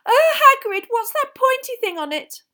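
very high, loud squeal of a question; squeamish girl. A narrator's reading. Lavender asking about the pointy thing, high and repelled. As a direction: squeal it in disgust.

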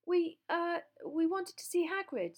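high, hesitant, sheepish; embarrassed, high-pitched and faltering; small voice. A narrator's reading. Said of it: "in a rather small voice".

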